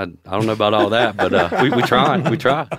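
chuckling through the words; laughing. Real, not acted. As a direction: laugh it off bashfully. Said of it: "laughing off compliment".

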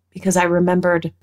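ordinary talk

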